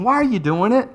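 acted, earnest challenge; acted questioning. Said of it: "he even asks the question".

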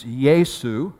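slow, careful Yesu, said YAY-soo; Greek Yesu. Shown as a demonstration. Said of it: "in the Greek".

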